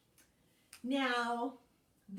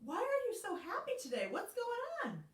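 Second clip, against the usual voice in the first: much higher, fast curious cat; Pete the Cat voice; 12 semitones higher than usual. Acted. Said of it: "So Pete said".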